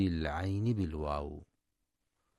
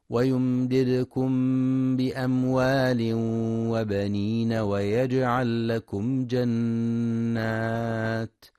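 slow, louder melodic tajweed chant; Quran recitation; 3 semitones higher, 6 dB louder, slower than usual. Acted. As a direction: chant the verse with long held vowels.

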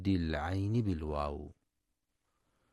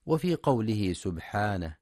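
ordinary talk, nothing marked

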